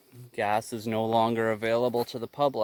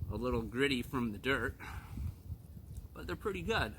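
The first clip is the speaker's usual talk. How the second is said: talking while chewing carrots; mouth full; 11 dB quieter, faster than usual. Real, not acted. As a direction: speak around a mouthful. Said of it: "I don't like talking with my mouth full of food".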